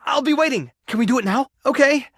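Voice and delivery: high, impatient excitement; keen and high-pitched; eager. Acted. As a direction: chirp it, can hardly wait. Eager anticipation.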